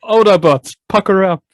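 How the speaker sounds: booming mock Optimus Prime call; mock commander call. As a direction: shout it like a robot leader.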